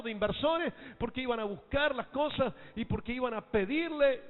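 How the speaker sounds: high, loud preaching, hammering each question; full-force preaching. Acted. Preacher rousing the congregation with loud, high preaching.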